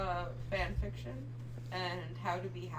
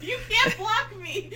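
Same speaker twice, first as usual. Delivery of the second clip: laughing shout; 10 dB louder, slower than usual. Real, not acted. Playful protest, laughing.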